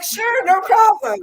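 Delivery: high, slow, sugary "sure, no problem"; mock sweet reply. Technician answers the customer in a slow mock-sweet voice. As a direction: play along with a sweet fake smile.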